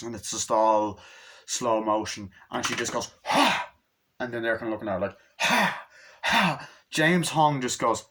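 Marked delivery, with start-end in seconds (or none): 3.2-3.7 short high-pitched "ha" yell; imitated fighting cry. 5.4-6.7 two short high "ha" yells; imitated fighting cries.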